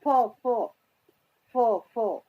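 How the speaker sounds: separate, repeated "फो" syllables; F sound drill. Shown as a demonstration. Teacher drilling the sound of F, repeated "फो, फो". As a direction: drill "फो" in evenly spaced pairs. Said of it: "एफ को हम कैसी sound दे रहे हैं".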